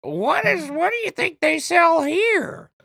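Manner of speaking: very high, drawn-out mock mom; squeaky mom impression, stretched out; nagging mom voice. Shown as a demonstration. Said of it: "do your mom's voice again?".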